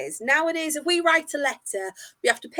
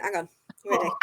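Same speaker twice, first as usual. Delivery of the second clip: ordinary talk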